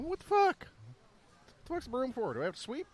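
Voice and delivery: high, startled "what the fuck"; high-pitched baffled outburst; startled disbelief. Retold or imitated. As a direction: squeak it out in shock.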